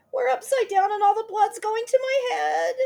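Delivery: very high crying wail; screaming. Acted. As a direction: wail in dizzy terror. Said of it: "screamed Missus Twit".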